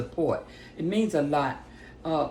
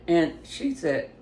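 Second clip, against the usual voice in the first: ordinary talk, nothing marked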